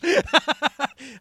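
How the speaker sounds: big burst of laughter up high; hearty laugh. Real, not acted. Preacher laughing at his own story to the congregation, big burst. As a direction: laugh out loud with delight.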